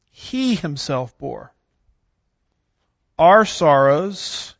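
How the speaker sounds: slow, weighty reading with pressed key words; emphatic scripture reading. Shown as a demonstration. Teacher reading the prophecy aloud to students, slow and emphatic. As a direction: read each phrase slowly, giving its words weight. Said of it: "from the way I emphasize some of those".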